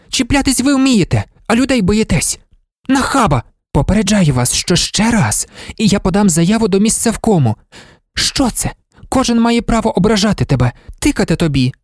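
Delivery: high-pitched indignant tirade, no real volume; angry shouting. A narrator's reading. Marta berating a pestering colleague, angry narrated shouting. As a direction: scold him in a high, heated voice. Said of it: "вона кричала".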